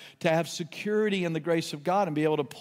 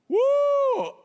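loud high whoop of joy; excited whoop; 5 dB louder than usual. Real, not acted. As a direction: let out a loud joyful woo. Preacher celebrates Jesus entering boat.

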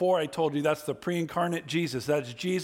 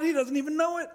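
ordinary delivery

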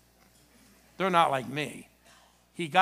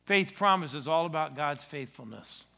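normal talking voice

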